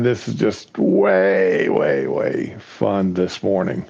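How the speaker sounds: slow, low, drawn-out "way"; wry understatement. Real, not acted. Co-host on a derailed morning call, drily. Wry comment after long mishaps.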